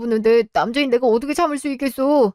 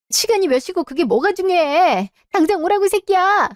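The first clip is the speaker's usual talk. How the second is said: furious yell; 8 semitones higher than usual. Acted. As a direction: scream at the cheating husband to get here now. Wife screams at husband on phone.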